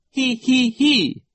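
very high, loud hee-hee-hee; silly put-on titter in three syllables; piercingly high mock giggle; laugh. A narrator's reading. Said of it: "Nero laughed".

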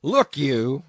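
loud warning roar; roaring captain. A narrator's reading. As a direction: roar to seize his attention. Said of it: "roared the captain".

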